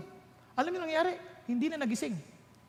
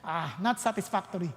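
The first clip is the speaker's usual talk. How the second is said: dismissive teacher; 9 semitones lower, 4 dB quieter than usual. Retold or imitated. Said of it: "sasabihin, teacher".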